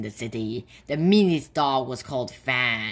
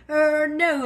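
caught out; 12 semitones higher, 4 dB louder than usual. Acted. Caught bully backing down.

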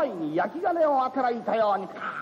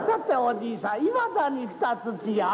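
ordinary talk

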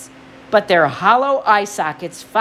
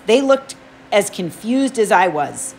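ordinary delivery, nothing marked

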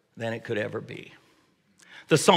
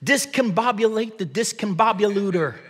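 higher mock sea captain barking orders; playful captain's command; 8 semitones higher than usual. Dad in the car playing spaceship captain for kids, mock yell. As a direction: play ship captain calling to the crew. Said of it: "I would yell out something like".

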